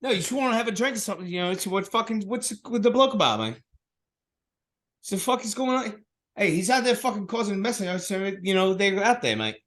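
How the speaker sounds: high-pitched matey Cockney patter; Cockney accent. Shown as a demonstration. Inviting a mate for a drink, Cockney accent. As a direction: ramble like a mock Cockney bloke. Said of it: "when I do a Cockney accent".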